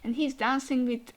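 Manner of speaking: usual speaking voice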